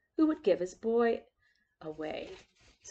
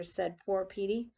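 ordinary delivery, nothing marked

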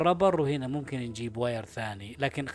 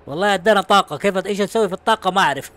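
loud, raised-pitch surprise; excited outburst; 4 semitones higher, 10 dB louder than usual. Real, not acted. Gamer baffled by newly gained energy, excited. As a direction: burst out loudly at the discovery.

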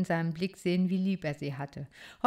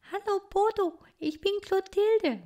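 a shy little titmouse speaking; young bird girl; 14 semitones higher than usual. Acted. Clotilde voiced by reader.

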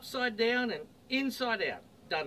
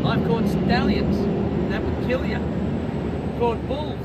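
loud boast; 3 semitones higher, 9 dB louder than usual. Acted. Billy boasting of rough rides.

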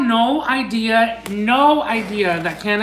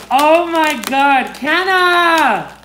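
drawn-out, rising cry of delight; overjoyed exclamation; 3 semitones higher, 3 dB louder, much slower than usual. Real, not acted. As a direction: call out to the sender, thrilled. Thanking the sender, overwhelmed.

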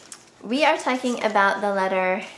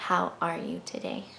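soft question; 3 semitones lower, 7 dB quieter than usual. Shown as a demonstration. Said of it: "it wants to be asked".

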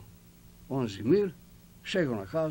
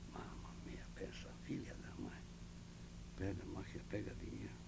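whispered aside; 4 semitones lower, 16 dB quieter than usual. Retold or imitated. Private thought, muttered aside.

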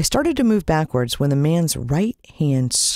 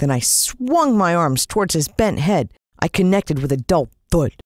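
usual speaking voice

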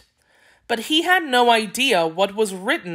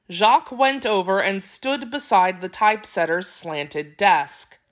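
ordinary delivery, nothing marked

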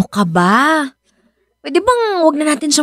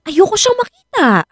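upset refusal; 9 semitones higher than usual. Acted. Wife refuses to see her ex.

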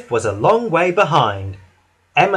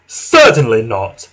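lower, loud snorted refusal; Gordon; 4 semitones lower, 6 dB louder than usual. A narrator's reading. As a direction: refuse loudly, with disdain. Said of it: "snorted Gordon".

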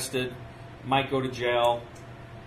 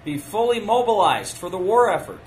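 rousing speech question; 5 semitones higher, 5 dB louder than usual. Retold or imitated. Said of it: "He says".

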